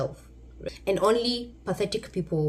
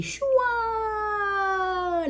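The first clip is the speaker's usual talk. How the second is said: high whistling swoosh; whooshing plane sound. Pretend. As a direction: imitate a jet flying off. Mimics plane taking off.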